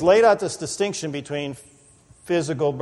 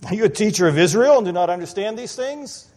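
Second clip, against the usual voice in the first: lightly mocking teacher's question, higher and louder; a little sarcastic; 6 semitones higher, 4 dB louder than usual. Jesus teasing Nick, mildly sarcastic and raised. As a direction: gently needle him with a raised voice. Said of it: "Jesus is a little sarcastic here".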